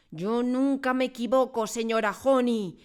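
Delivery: high, loud bullying retort; domineering shout. Acted. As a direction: slam her down with it. Tyrant headmistress contradicting.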